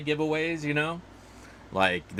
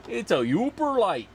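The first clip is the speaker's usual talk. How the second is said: worst-try Minnesotan accent, pitched higher; 9 semitones higher, 3 dB louder than usual. Shown as a demonstration. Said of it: "Doing my best, worst, uh, Minnesotan accent".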